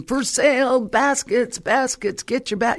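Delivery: high, called-out street seller's cry; market vendor. Retold or imitated. Vendor calls to the passing crowd, market cry. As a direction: hawk your wares across a busy market. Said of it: "The market is going".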